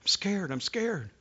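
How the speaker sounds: put-on frightened little girl's whisper; scared whisper. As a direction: whisper it like a terrified child. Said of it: "And she was scared".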